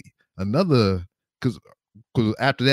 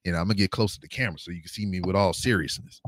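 usual speaking voice

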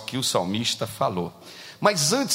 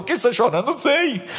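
put-on high girl voices; 10 semitones higher, faster than usual. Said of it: "A menina falou".

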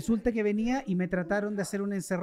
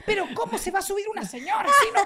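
very high mock-shouted disbelief; imitated exasperated mother; 12 semitones higher, 4 dB louder than usual. Mother arguing with her child, high exasperated imitation. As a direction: scold up high as the disbelieving mom.

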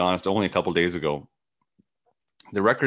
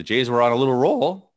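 a slight crack breaking through the voice; 4 semitones higher, 5 dB louder than usual. Real, not acted. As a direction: voice cracks on the last word. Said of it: "I don't know why my voice cracked".

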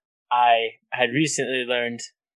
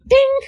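high, loud ding sound effect; a sharp high ding as the spoon head lands; imitated ding; 8 dB louder than usual. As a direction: ring it out like metal struck. Spoon head hits something outside.

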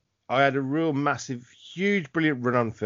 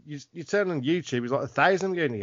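usual speaking voice

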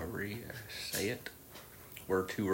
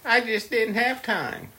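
higher, mock whining excuse; whiny excuse voice; 12 semitones higher, 5 dB louder, slower than usual. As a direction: whine like a lazy customer. Mimicking customers' excuses.

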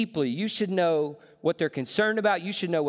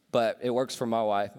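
ordinary talk

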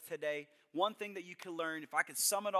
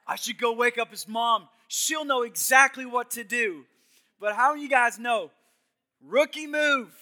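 urgent loud thought; 6 semitones higher, 11 dB louder than usual. Retold or imitated. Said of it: "I had this thought".

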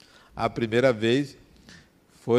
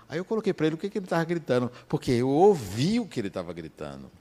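ordinary talk

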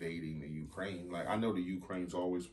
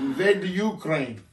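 put-on Russian accent; 11 semitones higher, 14 dB louder than usual. Joking about the war, booming mock Russian accent. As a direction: bellow it in a hammed-up Russian accent. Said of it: "that's a Russian accent or a Ukrainian accent".

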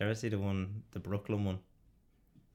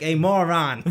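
shouted, higher Brooklyn insult; Brooklyn accent; 6 semitones higher, 13 dB louder, slower than usual. Acted. Brooklyn student insulting his teacher, shouted Brooklyn accent. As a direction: yell it in mock Brooklyn. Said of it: "He's like".

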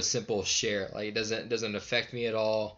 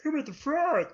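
very high puppet frog voice; Kermit the Frog; 3 dB louder than usual. Acted. Man showing his best impression, high puppet frog. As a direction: squeeze it up high like a cartoon puppet. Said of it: "I can do, uh, um, Kermit the Frog".

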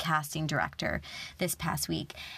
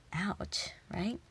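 whispered; 6 dB quieter than usual. Real, not acted. Wincing reaction to the harsh truth.

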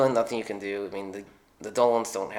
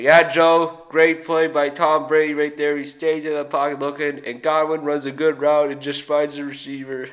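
higher, louder color commentator; Troy Aikman impression; 5 semitones higher, 8 dB louder than usual. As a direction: break down the play like an ex-quarterback analyst. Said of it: "Here comes Troy Aikman".

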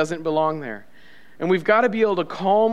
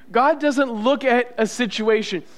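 ordinary talk

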